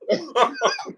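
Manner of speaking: high burst of giggling; laughing it off. Real, not acted. Laughing at the mispronunciation.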